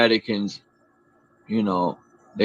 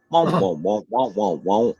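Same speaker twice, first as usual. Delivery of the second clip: muffled trombone wonk-wonk sound; Charlie Brown adult voice. Retold or imitated. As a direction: imitate the Peanuts teacher's wah-wah. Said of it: "You sound like that dude from, uh, uh, Charlie Brown".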